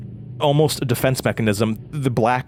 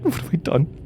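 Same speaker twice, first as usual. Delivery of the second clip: panicked; 8 semitones higher than usual. Acted. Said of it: "I said".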